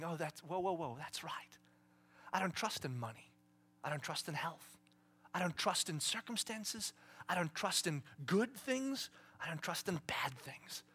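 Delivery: softly spoken, partly whispered confession; quiet realization. Acted. Believer answering God, quiet realization.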